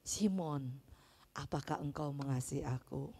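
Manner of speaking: Jesus asking Peter softly and lovingly; full of love. A narrator's reading. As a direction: ask him tenderly, almost hushed. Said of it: "dengan penuh kasih".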